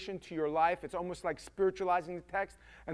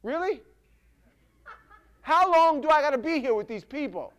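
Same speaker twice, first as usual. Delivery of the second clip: exasperated outburst, higher and louder; Jesus, frustrated; 7 semitones higher, 9 dB louder than usual. Acted. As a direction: act out losing patience with everyone around. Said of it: "he even gets so frustrated".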